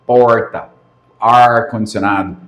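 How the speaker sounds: Piracicaba caipira r, rolled back in "Porta" and "ar-condicionado"; Piracicaba accent. Retold or imitated. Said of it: "a gente imita o piracicabense falando".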